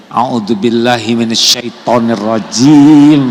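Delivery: slow, loud, pointed recitation at the husband; pointed ta'awwudz. Retold or imitated. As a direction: recite it slowly to needle him. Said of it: "Misalkan suami ngejengkelin". (mengejek sambil ta'awwudz)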